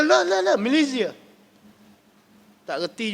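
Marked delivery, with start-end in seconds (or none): 0.0-1.1 higher, eager "La, la, la" then a flat "Malaysia"; lightly voiced protest "no, no, Malaysia". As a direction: mimic a pilgrim protesting at Mecca. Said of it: "Kita kata".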